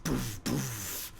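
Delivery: ordinary delivery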